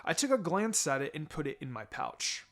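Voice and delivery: plain reading voice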